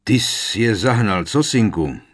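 booming, jovial boast on a son's behalf; loud blustering uncle; loud and hearty. A narrator's reading. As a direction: boom it out proudly. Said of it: "zahlaholil hlasitě strýc Vernon".

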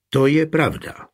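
plain reading voice

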